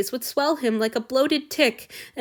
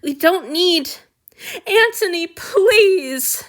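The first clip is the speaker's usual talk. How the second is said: high, loud, desperate begging; anguished plea; 8 semitones higher, 7 dB louder, slower than usual. A narrator's reading. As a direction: beg him as if it hurts. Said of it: "The real anguish quavering through the man's voice".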